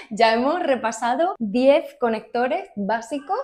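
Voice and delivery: laughing through the words. Real, not acted. Teacher laughing at her quick funny video, giggly. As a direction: giggle at her own joke mid-sentence. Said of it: "tan gracioso".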